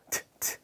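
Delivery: "t" as a very soft voiceless tap, twice; isolated consonant sound. Shown as a demonstration. Teacher showing the first sound of tall, voiceless "t". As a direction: sound out just the "t" without voice. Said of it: "첫 소리가 자음으로 발음되는".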